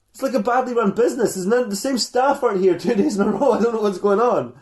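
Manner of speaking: high, loud bewildered complaint; mock exasperated rant. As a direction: rant like a kid at wits' end. [name] rants at his mum.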